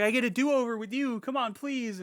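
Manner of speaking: high, softening wheedle; put-on imploring voice; acted desperate pleading. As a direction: imitate a husband begging his wife. Voices husband begging wife.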